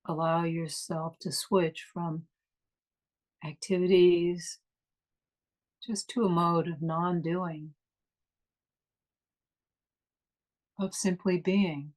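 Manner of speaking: guided meditation. Acted. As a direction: ease them inward, unhurried and calm.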